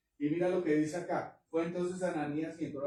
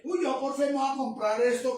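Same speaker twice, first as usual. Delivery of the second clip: mock believer; 10 semitones higher, 8 dB louder than usual. Mocks a believer acting on emotion.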